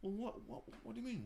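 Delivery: high, indignant double take. Retold or imitated. Reacting to the online comment, high and indignant. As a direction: protest as if insulted.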